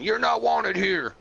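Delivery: high, drawn-out mock heckle; retold heckler. Someone in the ad rejects the new arrival, mock heckling. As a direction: call it out high and slow.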